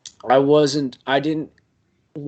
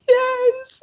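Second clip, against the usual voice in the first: high squeak; 3 dB louder than usual. Retold or imitated. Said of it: "she's like".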